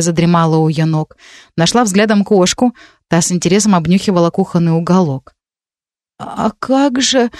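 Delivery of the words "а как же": slow, hesitant drawl; drawn out. A narrator's reading. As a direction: stretch the words out uncertainly. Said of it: "наконец протянула Марина".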